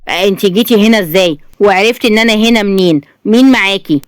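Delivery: ordinary delivery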